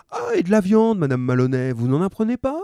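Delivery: high, lightly voiced shopkeeper; friendly grocer. A narrator's reading. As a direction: chat like a helpful grocer. Grocer offers meat.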